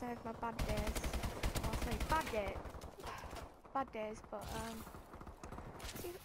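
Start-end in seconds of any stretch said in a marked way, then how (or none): none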